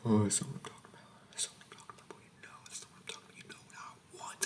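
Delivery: menacing drill sergeant in a soft whisper; creepy whispering sergeant. Acted.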